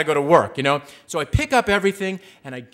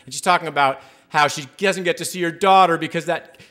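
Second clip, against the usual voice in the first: ordinary delivery, nothing marked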